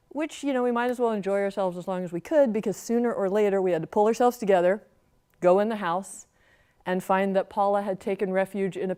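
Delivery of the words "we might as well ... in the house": ordinary delivery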